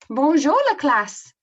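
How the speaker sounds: loud, high comic teacher voice; French exchange teacher. Acted.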